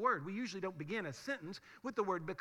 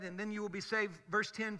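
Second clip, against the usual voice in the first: ordinary talk, nothing marked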